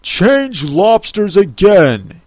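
high, slow, swooping Gryphon yell. A narrator's reading. Gryphon calls the next dance step, yelling. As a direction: call out across the dance, drawn out. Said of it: "yelled the Gryphon at the top of its voice".

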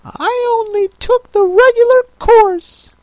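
very high tearful creature voice; Mock Turtle voice. A narrator's reading.

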